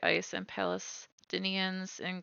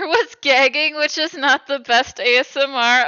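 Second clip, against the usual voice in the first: laughing; 5 semitones higher, 15 dB louder than usual. Real, not acted. Laughing about partner gagging.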